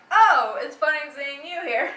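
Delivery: hammy fake surprise; loud, overacted hello; staged surprise. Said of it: "It sounds staged".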